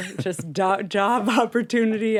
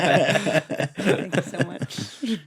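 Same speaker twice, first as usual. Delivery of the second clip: laughing; much slower than usual. Pretend. Joking as an original Friends cast member.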